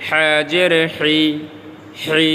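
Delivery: 'হা' and 'হি' with a tight throat; pressed throat H. Shown as a demonstration. Teacher repeats the letter for learners, 'হি' squeezed in the throat. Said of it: "হলকের মধ্যে pressure দিতে হবে".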